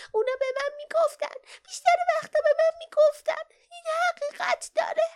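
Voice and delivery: very high-pitched put-on creature voice; sobbing small creature. A narrator's reading. Perin explains the mosses while washing its paws, high sobbing creature voice. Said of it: "حق حق کرد".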